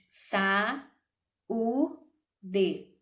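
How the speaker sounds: sa, u, d spoken as separate syllables with pauses; syllables split apart. Shown as a demonstration. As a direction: break saúde into its syllables one by one. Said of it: "Nós separamos".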